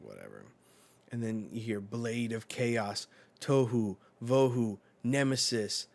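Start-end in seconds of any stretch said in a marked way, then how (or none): 0.0-0.4 very low, soft bored mumble; a deep, flat, bored dismissal; dismissive. Retold or imitated. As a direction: toss it away, unimpressed. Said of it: "he's just like".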